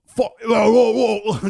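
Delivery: loud, high garbled Cockney noises; Cockney caricature. Retold or imitated. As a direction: fumble loudly through mock Cockney mumbo-jumbo. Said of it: "Cockney snatch".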